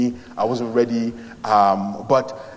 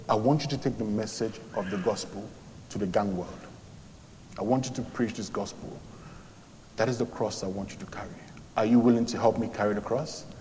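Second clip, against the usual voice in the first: much softer, low divine voice; voice of God; 3 semitones lower, 9 dB quieter than usual. Retold or imitated. God commissioning him to preach to gangs, hushed low. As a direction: speak it low and hushed like a calling. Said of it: "the voice now spoke back to me and said".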